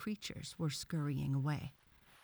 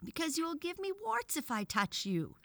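put-on high-pitched, self-justifying boy voice; defensive boy; 13 semitones higher than usual. A narrator's reading. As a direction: blurt the excuse in a high schoolboy voice. Boy defends himself with a reason.

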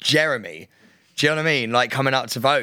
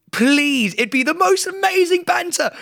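excited begging; 4 dB louder than usual. Real, not acted. Begging listeners for votes.

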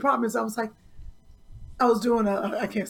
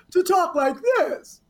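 lightly imitating her cartoon voice; slow mock character voice; mimicked cartoon voice; 5 semitones higher, slower than usual. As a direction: tease with a drawn-out imitation. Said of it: "I was always talking like this".

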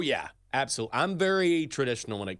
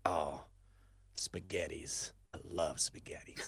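low, soft mumbling imitation of the linebacker; 7 semitones lower, 10 dB quieter than usual. Retelling the linebacker's answer in a low voice. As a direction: mimic the player quietly and low. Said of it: "and he goes".

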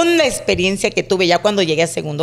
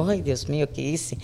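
scared whisper-like gasp; 4 semitones lower, 10 dB quieter than usual. Retold or imitated. Sees the blood, gets scared.

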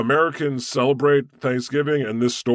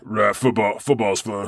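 bro voice; 4 semitones lower than usual. Pretend. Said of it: "You have a good American accent".

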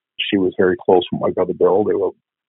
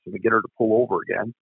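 usual speaking voice

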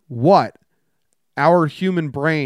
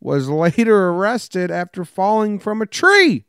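slow, deliberate newscaster read with deadpan punchlines; news read; 6 semitones higher, 4 dB louder, slower than usual. Acted. Host reading odd news headlines to his co-host, newscaster style. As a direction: read the headlines like an evening anchor.

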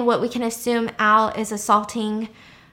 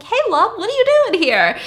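very high, loud mock-sweet hello; chirpy greeting; 12 semitones higher, 7 dB louder than usual. Cooper greeting her at work, high and chirpy. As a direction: sing out an over-friendly greeting.

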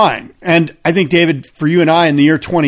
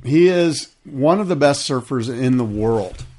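ordinary talk, nothing marked

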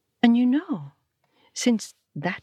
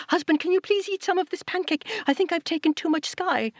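much higher voice, begging; high pleading wife; 10 semitones higher than usual. Acted. Satu begging her husband to eat the pancake, high and pleading. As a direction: plead with him to help finish it.

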